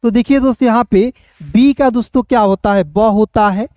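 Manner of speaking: ordinary talk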